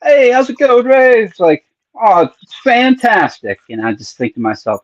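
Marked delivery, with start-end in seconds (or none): 0.0-3.6 much higher and louder, a forced sunny chirp; fake cheery greeting. Coworker greeting him at work, fake cheerful and loud. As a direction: beam out a fake happy hello. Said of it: "it'll be like".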